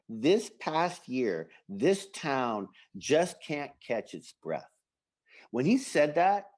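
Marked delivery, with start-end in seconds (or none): 0.1-4.7 slow, measured recital; weighty quoted line. Retold or imitated. Unser getting emotional about the town, slow and weighty. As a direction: draw each phrase out deliberately. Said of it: "Like, when he goes".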